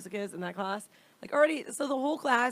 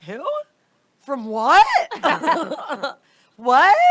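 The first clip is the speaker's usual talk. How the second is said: higher and much louder, baffled; confused surprise; 7 semitones higher, 14 dB louder, much slower than usual. Retold or imitated. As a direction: blurt it out, totally lost. Woken by a forgotten interview call.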